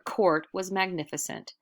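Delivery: ordinary delivery, nothing marked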